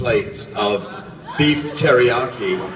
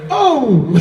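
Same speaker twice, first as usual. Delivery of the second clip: high, loud startled oh with a laugh; sudden laughing yelp; 11 semitones higher, 6 dB louder than usual. Retold or imitated. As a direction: jump at the spill. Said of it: "Adam went".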